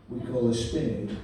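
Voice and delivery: put-on low, slow Yorkshire accent; lightly voiced plain-speaking Yorkshireman's accent. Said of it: "you know what Yorkshire for".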